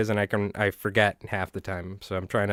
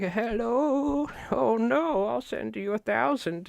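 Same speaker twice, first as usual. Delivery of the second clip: much higher, thin mock old lady voice; elderly mother; 12 semitones higher, 4 dB quieter, much slower than usual. Streamer imitates an elderly mother on a scam call, high mock old lady voice. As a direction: play a flustered old mother being scammed. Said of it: "your elderly mother's like".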